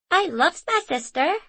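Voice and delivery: put-on a toddler generously declaring love; charitable little boy. A narrator's reading. Small boy fondly speaking of his sister. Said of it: "said Hari charitably".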